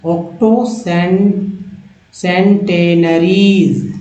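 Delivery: octoसेंटेनरीज pulled out very slowly; teacher drawing out octoसेंटेनरीज; very slow reading. Shown as a demonstration. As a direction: sound out octoसेंटेनरीज piece by piece. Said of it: "teacher reads a long word".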